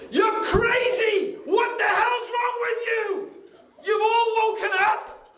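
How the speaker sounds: high-pitched, urgent warning shout; alarmed shouting. Acted. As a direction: shout as if an army is about to attack. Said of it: "that's how the Prophet used to shout".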